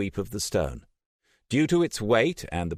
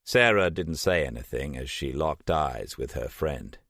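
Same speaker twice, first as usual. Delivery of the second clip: ordinary delivery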